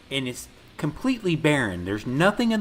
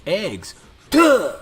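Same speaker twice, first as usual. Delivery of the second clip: mock dopey kid voice, much higher and louder on bacon; 9 semitones higher, 7 dB louder, slower than usual. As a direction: ham up a dim kid blurting it. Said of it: "And he goes".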